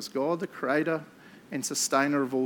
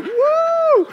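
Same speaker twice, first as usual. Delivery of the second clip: high, loud whoop of glee; 10 dB louder than usual. Retold or imitated. Husband speeding to hospital, high gleeful whoop. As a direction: whoop like on a joyride. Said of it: "I was just like".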